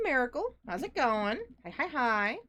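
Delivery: ordinary talk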